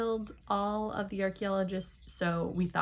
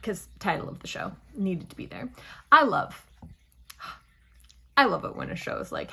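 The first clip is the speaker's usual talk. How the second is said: ordinary talk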